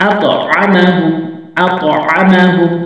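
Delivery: আত আমাহুম stretched out slowly; slow demo reading. Shown as a demonstration. Teacher to students, slow careful demonstration. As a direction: sound out each syllable for the class. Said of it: "teacher demonstrates joined reading".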